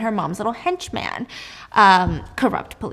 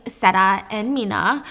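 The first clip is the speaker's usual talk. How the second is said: normal talking voice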